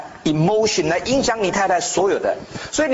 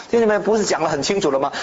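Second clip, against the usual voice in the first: ordinary delivery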